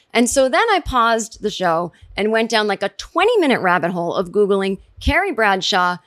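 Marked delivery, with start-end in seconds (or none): none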